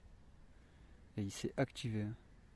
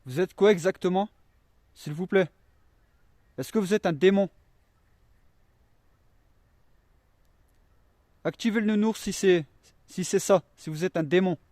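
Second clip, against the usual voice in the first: calling out loud; 5 semitones higher, 10 dB louder than usual. Real, not acted. Calling out to spirits.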